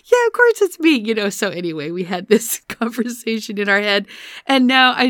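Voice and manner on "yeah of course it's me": put-on very high, loud child voice; squeaky imitated little-girl reply; seven-year-old girl. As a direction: answer as a cheeky little kid. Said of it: "And she's like".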